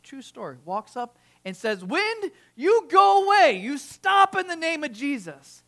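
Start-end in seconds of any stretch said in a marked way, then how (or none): 1.8-5.6 imitated commanding shout. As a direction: order the wind away like a preacher. Pastor commanding the wind, retold.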